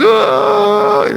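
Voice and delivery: sudden high hammed-up cry of "wah"; mock wail. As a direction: burst into a fake high wail. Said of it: "Ada yang nangis".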